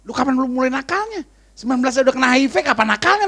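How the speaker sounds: high-pitched acted disbelief; astonished inner outburst, performed; incredulous questioning. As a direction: play baffled shock, voice up. Acts incredulous inner reaction.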